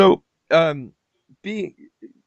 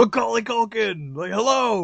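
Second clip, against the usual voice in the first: high-pitched imitation of calling out, no real volume; mock screaming; 7 semitones higher than usual. Fan hailing film stars backstage after the panel, mock screaming. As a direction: holler it in miniature, pitched up. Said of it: "we just started screaming at these guys".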